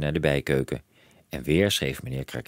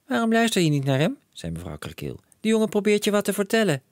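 a touch of reproach, higher; lightly voiced mother. A narrator's reading. Mother scolding her husband, lightly reproving voice. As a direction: take the boy's side.

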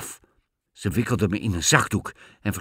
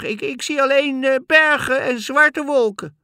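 put-on very high, louder, hesitant girl's voice; calling back; 7 dB louder than usual. A narrator's reading. Sister reports from the tower, calling back high. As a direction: call down haltingly from high up. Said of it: "riep Anna terug".

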